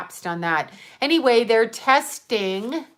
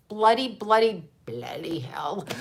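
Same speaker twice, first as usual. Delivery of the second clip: mock British; 3 semitones lower than usual. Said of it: "I'm speaking British".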